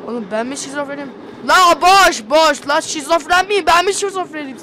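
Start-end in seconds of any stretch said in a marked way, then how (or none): 1.5-4.6 loud disbelieving yell; frantic shouting. Real, not acted. Frantic yelling about ghost.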